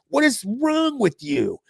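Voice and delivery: high, strained yell of disbelief; angry scolding. Retold or imitated. Dad berates son in front of mom, imitated angry yell. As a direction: imitate a father's exasperated outburst.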